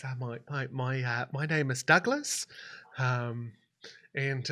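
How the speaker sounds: put-on soft, halting, hesitant young man; meek and apologetic; timid grandson. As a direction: speak quietly, stumbling over the introduction. Shy grandson explains himself.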